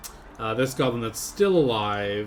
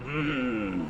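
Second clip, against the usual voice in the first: ordinary delivery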